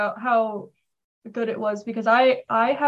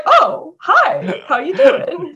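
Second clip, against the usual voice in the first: mock greeting; 6 semitones higher, 7 dB louder, much slower than usual. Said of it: "I'm like".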